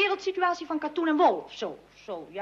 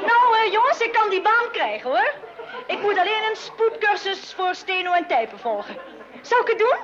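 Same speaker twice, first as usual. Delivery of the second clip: higher, louder, laughing as she tells it; excited news; 5 semitones higher, 4 dB louder than usual. Acted. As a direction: burst in with good news. Daughter announces job offer excitedly.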